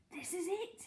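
whispered to himself. A narrator's reading. Mister Hoppy on the stairs to himself, whispering. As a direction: whisper it privately. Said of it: "he whispered to himself under his breath".

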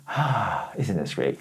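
softly, with a relaxed exhale; soft, contented vacation sigh; soft relieved sigh. Shown as a demonstration. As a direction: sink into the sun and sigh it out. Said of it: "I'll give you an example".

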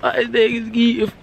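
high, slow mock Baltimore drawl; dragged Baltimore accent. Showing how he doesn't talk, dragged Baltimore accent. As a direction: stretch it out in an exaggerated Baltimore accent. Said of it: "I don't talk like that".

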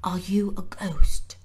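hushed, wary whisper; whispered question. A narrator's reading. Frightened boy whispering to Mary in the night. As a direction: whisper it as if she might vanish.

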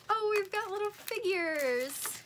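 high and loud burst of delight; excited. Real, not acted. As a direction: squeal it as the figures appear. Discovering figurines in the box.

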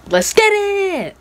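high, loud, drawn-out battle cry; hyped-up yell before charging in; rallying shout. Acted. Show host rallying before raccoon fight.

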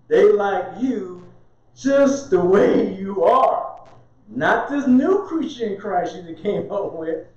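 slow, teasing stretch; slow sing-song tease; drawn out. Acted. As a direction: draw each word out mockingly. Mocking family's preference, drawn out.

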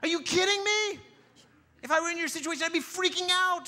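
high, loud mock exasperation; exasperated outburst. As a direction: blurt it out, fed up and baffled. Exasperated reply to calm believer.

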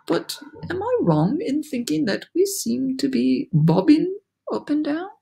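high, polite questioning voice; tentative ladybug. A narrator's reading. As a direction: raise it delicately into a question. Ladybug timidly asks a question.

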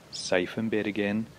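ordinary delivery, nothing marked

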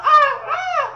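high, loud yelps of pain with a laugh; pained cries. Retold or imitated. A boy yelping as his dad beats him, pained cries. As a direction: cry out like each hit lands. Crying out while beaten.

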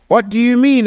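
mild outburst of disbelief; Nicodemus exclaiming. A narrator's reading. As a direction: read it as a puzzled man's exclamation. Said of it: "exclaimed Nicodemus".

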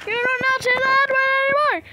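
fast, high, loud angry shout; shouting parent scold; furious scolding. Acted. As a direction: rip into the kid.

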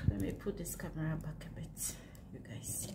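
ordinary delivery

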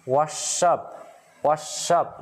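"Wash up" with the sh carried into "up"; linked pronunciation. Shown as a demonstration. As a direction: link "Wash up" as one run-on phrase. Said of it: "đọc nối sang".

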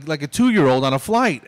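normal talking voice

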